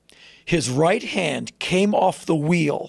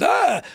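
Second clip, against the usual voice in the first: cartoon yelp; 12 semitones higher than usual. Imitating a Boris and Natasha character's high cartoon yelp. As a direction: yelp like a startled cartoon villain. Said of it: "the guy went".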